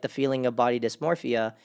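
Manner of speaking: normal talking voice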